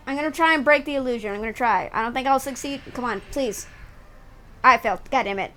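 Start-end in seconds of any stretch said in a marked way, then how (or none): none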